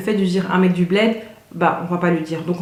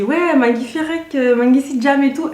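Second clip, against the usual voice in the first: put-on higher, slow Wolof greeting with a foreigner's French accent; self-mocking outsider accent in Wolof; clumsy Wolof accent; 6 semitones higher than usual. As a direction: say the Wolof words like a diaspora kid who barely speaks Wolof. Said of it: "dans mon accent, on entend trop que je parle pas Wolof".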